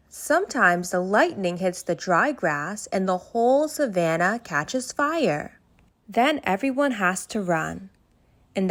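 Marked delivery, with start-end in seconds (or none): none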